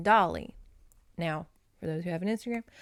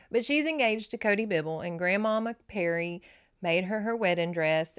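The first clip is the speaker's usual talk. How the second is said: ordinary delivery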